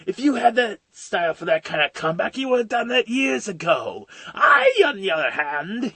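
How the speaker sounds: high, lilting villain mockery; Joker scoffing at Batman. Villain belittles the hero's comeback, gleefully mocking. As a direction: scoff theatrically at the threat.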